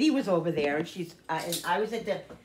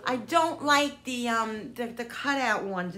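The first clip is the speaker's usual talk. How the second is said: higher, slow whiny insistence; insisting on drop biscuits; mild frustration; 7 semitones higher than usual. Real, not acted. Frustrated at wrong recipe.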